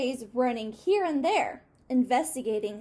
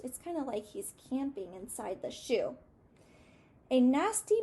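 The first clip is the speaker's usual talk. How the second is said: ordinary delivery, nothing marked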